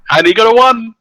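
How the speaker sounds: high, loud disbelief at the dice; excited exclamation. Real, not acted. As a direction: burst out with delighted amazement. Player exclaims at the dice result.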